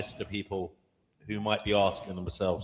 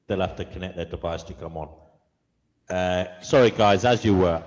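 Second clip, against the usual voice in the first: ordinary talk, nothing marked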